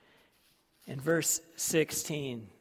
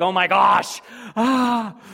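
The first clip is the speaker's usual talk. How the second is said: panicked shout; 7 semitones higher, 15 dB louder, slower than usual. Retold or imitated. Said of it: "we'd be like".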